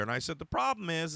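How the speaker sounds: ordinary talk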